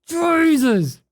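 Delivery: laughing exclamation. Real, not acted. Laughing while reading chat.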